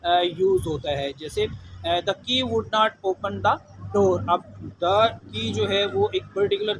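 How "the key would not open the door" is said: slow, deliberate example with the key stressed; careful teacher dictation; slow example read. Shown as a demonstration. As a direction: dictate it slowly, leaning on key. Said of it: "example sentence read slowly".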